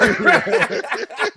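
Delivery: high burst of laughter; a short happy cackle; real laugh. Real, not acted. Hearing his friend's story, bursting out laughing.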